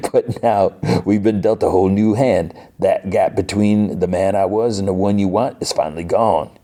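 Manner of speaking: badly faked Cajun accent; Gambit. Gambit woos Rogue, terrible hammy Cajun accent. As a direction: ham up a Cajun lover's speech. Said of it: "the worst Gambit accent ever".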